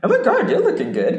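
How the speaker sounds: high, laughing gush of a friend. Retold or imitated. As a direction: imitate a delighted friend, laughing. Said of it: "She was like".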